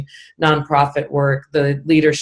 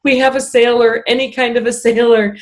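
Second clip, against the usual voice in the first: higher, louder retold cheer; mock delight; 7 semitones higher, 4 dB louder than usual. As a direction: imitate a coach thrilled to get any sailor. Acting out coach's delighted reaction.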